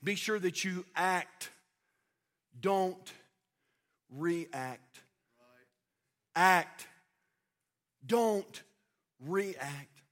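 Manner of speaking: slow, low, spaced-out words; soft, weighty maxim with long pauses; pastor's measured saying. Retold or imitated. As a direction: quote it deliberately, pausing between words. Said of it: "used to say it like this".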